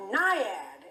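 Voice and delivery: usual speaking voice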